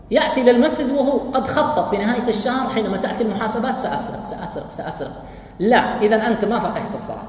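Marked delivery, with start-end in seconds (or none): 3.8-5.1 whispered scheming to himself; sly whisper. Retold or imitated. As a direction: hiss it like a plotting thief. Thief planning theft, whispered.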